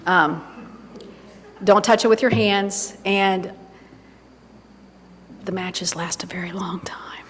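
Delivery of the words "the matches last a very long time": whispered aside, much softer; a hushed joking aside. Real, not acted. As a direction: let it slip out in a whisper like a confession. Joking aside about long matches.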